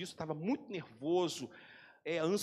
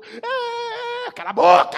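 much higher then very loud yell; mock shout; 15 dB louder, slower than usual. Pastor mocking his own harsh scolding, a loud yell. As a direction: bark it like a boxer in the ring. Voicing himself beating her verbally.

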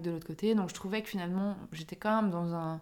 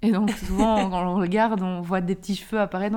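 ordinary talk, nothing marked

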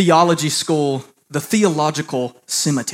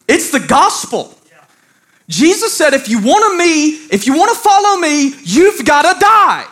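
high, loud pulpit proclamation; full-force preaching; 12 semitones higher, 5 dB louder than usual. Real, not acted. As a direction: proclaim it with urgent conviction, pitch held up.

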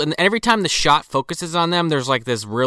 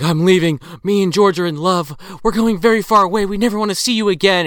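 lightly voiced, higher giddy runaway; a hint of a lovestruck sister leaving for good; 5 semitones higher, 4 dB louder than usual. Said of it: "And she's like".

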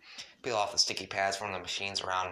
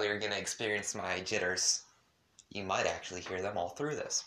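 usual speaking voice

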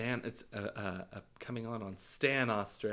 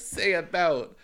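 higher, louder mock demand; imitated teasing locals; 7 semitones higher, 6 dB louder, slower than usual. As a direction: goad a Canadian to say the word, in a put-on voice. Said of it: "And they'll be like".

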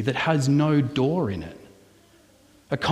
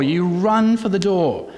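ordinary talk

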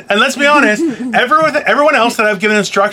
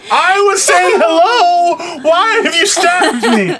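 high, slow, hammed-up wounded cry; mock wailing; 8 semitones higher, slower than usual. Host pretending co-host stabbed him, mock wailing. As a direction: wail in fake agony, drawn out high.

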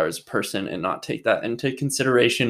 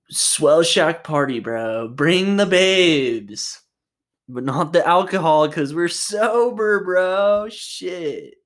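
high, slow, hammed-up surfer dude; Dylan's surfer bro voice; 6 semitones higher, slower than usual. Mocking his friend's surfer speech, hammed-up surfer bro. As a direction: drawl it like a sober beach bro. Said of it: "now he talks like that".

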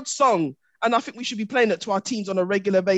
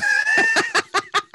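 high-pitched burst of laughter; high, sustained laughing shriek. Real, not acted.

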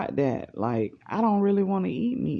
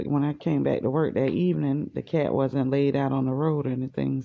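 normal talking voice

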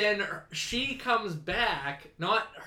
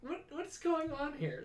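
acted bewilderment; 10 semitones higher, 5 dB quieter than usual. Viewer lost by the returning character, acted bewilderment. Acts out own confusion as viewer.

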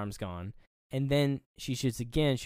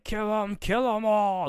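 high, raised mock fury; pissed-off villain; 10 semitones higher, 5 dB louder than usual. Enraged doll ordering everyone killed, mock furious yell. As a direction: rage it out as an imitated angry doll. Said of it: "he's fucking pissed".

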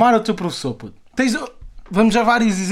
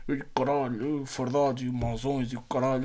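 tough cop impression; 5 semitones lower, 9 dB quieter than usual. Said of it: "tipo aqueles mais".

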